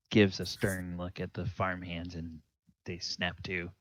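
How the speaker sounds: ordinary talk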